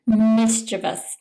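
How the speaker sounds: ordinary delivery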